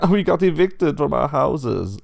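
high, loud, slow whining pigs; whiny evicted pigs; pig voices. Acted. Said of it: "And they go".

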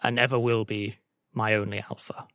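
lightly acted pack-leader vow; quoted solemn declaration. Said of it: "I believe the exact phrasing was".

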